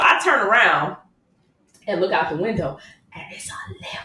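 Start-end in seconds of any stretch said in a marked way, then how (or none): 3.1-4.0 breathless whispered reveal. Retold or imitated. Wife revealing husband's surprise limo, whispered. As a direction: let the secret slip out in a hush.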